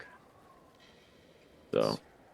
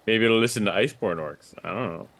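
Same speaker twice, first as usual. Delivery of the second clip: ordinary delivery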